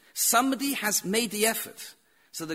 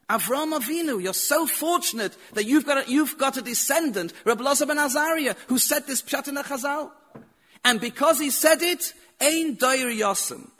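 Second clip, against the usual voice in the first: higher, louder, thrilled blessing; excited praise; 7 semitones higher, 4 dB louder than usual. Retold or imitated. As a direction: burst out in joyful praise. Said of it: "he got so excited".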